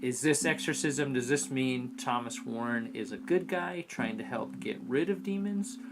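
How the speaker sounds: usual speaking voice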